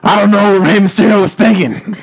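a bad, half-there wrestler voice; Macho Man. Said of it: "I'm gonna do a horrible impression".

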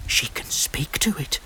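shocked villagers whispering; horrified whisper. Acted. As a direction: breathe it out in fear. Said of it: "the watching villagers whispered".

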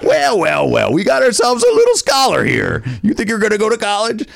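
high, loud screaming motivational speaker; mocking yell at a know-it-all, way up high; Matt Foley impression. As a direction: bellow it like an unhinged van-dwelling coach. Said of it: "he's just screaming".

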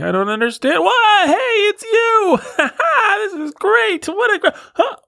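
put-on very high, gushing, wide-eyed baby wonder; be a baby amazed by peekaboo. Host imitating a baby at peekaboo, very high and amazed. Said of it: "That is like babies".

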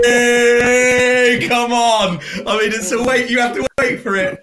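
loud, high urging of the listeners; pleading loudly for a laugh at the pun; mock hype. Real, not acted. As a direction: cheer your own joke on. Hyping his own punchline.

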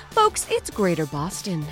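ordinary talk, nothing marked

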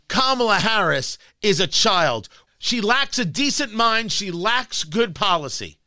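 usual speaking voice

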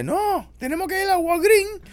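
high, loud mock-grandma refusal; insistent grandma. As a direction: overrule the grandson, hammed up. Acting grandma refusing to go home. (voz aguda de abuela mandona)

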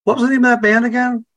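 high, fast mock-eager voice; imitating Tom Cruise. Retelling Tom Cruise asking for the band name, high mock voice. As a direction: pitch way up as the star asking casually. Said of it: "he, he was like".

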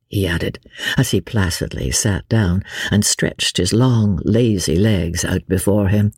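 ordinary delivery

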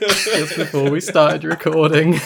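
slow words through laughter; barely holding the laugh in. Real, not acted. Cracking up while explaining.

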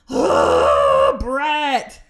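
loud groan into a yelled name; driven-crazy outburst; exasperated. Retold or imitated. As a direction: groan hugely, then yell in frustration. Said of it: "It drives me crazy".

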